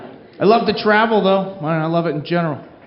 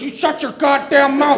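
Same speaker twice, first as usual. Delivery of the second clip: angry lady; 4 semitones higher than usual. Retold or imitated. Crazy lady snaps at the man.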